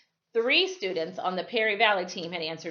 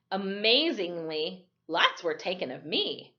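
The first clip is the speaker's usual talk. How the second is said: usual speaking voice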